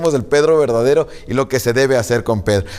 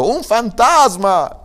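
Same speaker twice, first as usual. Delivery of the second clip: frightened cry, higher and drawn out; voces de miedo; 8 semitones higher, 3 dB louder, much slower than usual. A narrator's reading. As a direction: read it as an alarmed, stretched-out cry. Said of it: "dieron voces de miedo". (grito asustado y alargado)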